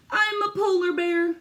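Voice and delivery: excited animal line. Acted. Voicing the book's animal line.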